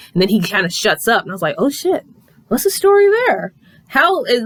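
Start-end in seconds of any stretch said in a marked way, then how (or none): 1.6-4.4 intrigued surprise. Retold or imitated. Own reaction while reading.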